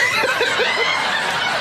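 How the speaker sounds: breathy high burst of laughter. Real, not acted. Telling the ice cream story, high laughing burst. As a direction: laugh out at the joke.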